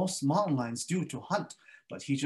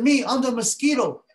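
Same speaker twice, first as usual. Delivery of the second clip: high, loud tiny voice; little mosquito; 10 semitones higher, 8 dB louder than usual. Acted. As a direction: announce yourself proudly, high. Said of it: "He said".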